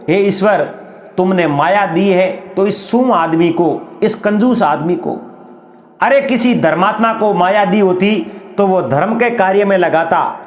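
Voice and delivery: ordinary delivery, nothing marked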